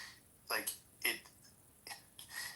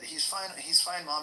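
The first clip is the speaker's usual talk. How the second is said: high, louder insistence; exasperated reassurance; 7 semitones higher, 4 dB louder, slower than usual. Retold or imitated. To his mom about his son, exasperated and louder. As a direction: brush her worry aside. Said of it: "And I said".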